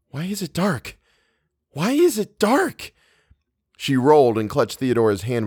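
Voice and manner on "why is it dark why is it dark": high, hushed inward scream of panic; screaming in thought. A narrator's reading. Eleanor panicking in the dark beside Theodora, screamingly. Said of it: "thought screamingly".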